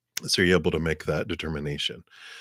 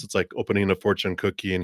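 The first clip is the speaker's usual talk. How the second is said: ordinary delivery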